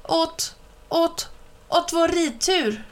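high, teasing mimicked stutter; mocking stammer imitation. A narrator's reading. Mike parrots Ben's stammer, high and teasing. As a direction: stutter on purpose to mock. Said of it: "Han älskade att härma Ben".

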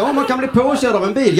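mock worry. Mimics worried helmet defender.

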